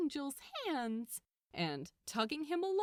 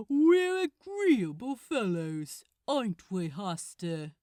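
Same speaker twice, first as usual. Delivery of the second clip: mocking demon; 8 dB louder than usual. Demon Haster taunting the angel.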